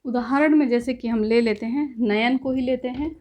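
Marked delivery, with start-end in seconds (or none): none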